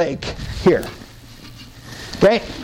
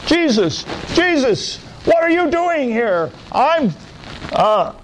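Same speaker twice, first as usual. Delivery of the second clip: much higher, anguished voice; tormented man; 9 semitones higher than usual. Acted. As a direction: plead as the tormented demoniac. Possessed man confronts Jesus.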